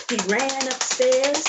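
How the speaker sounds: ordinary delivery, nothing marked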